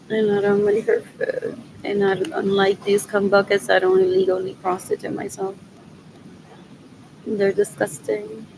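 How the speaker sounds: ordinary talk, nothing marked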